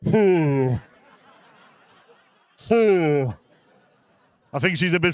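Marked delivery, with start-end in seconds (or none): 0.0-3.3 breath forced out in short hoos; winded huff. Retold or imitated. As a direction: huff as the wheel squeezes your chest. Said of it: "I went".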